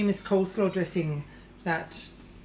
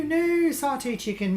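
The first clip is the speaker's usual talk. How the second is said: correcting herself; 6 semitones higher, 5 dB louder than usual. Acted.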